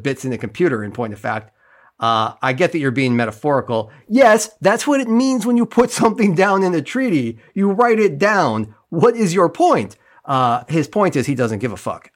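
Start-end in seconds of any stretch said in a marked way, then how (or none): 4.1-10.0 exasperated rant. Real, not acted.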